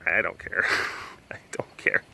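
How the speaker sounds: low mumble fading into a whisper; dismissive hush. Acted. Shrugging off explaining to people, hushed dismissal. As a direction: shrink it down to a chuckled whisper.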